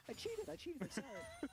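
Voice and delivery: laughing confession. Real, not acted. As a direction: laugh it out, caught red-handed. Caught breaking a joke rule.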